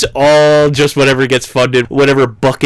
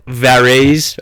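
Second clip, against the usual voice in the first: ordinary talk, nothing marked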